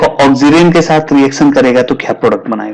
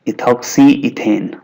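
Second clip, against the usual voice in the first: slow, spaced-out dictation of a term; slow naming; much slower than usual. Shown as a demonstration. Teacher repeating the IUPAC name for students, slowly. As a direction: draw the name out part by part.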